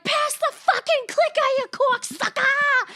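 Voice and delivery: high, hammed-up Boston shout; Mark Wahlberg. Actor slipping into his hometown talk, shouted Boston accent. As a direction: bellow it in thick Boston, stretching the end. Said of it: "slips back into his natural Boston".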